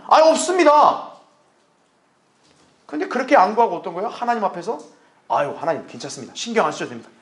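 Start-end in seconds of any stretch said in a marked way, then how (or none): 0.1-1.1 high, loud, drawn-out admission; immediate answer. Shown as a demonstration. Believer admits no confidence to the Lord, high loud answer. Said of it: "즉각적으로 대답을 해야죠". 5.3-7.1 lower, softer polite refusal; over-considerate believer. Acted. Believer declining God's help, mock-considerate soft voice. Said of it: "우리 하나님을 너무 생각해요".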